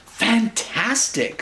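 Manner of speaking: high, slow gushing praise; mock delight. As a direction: lay on the flattery. Princess praising the dragon.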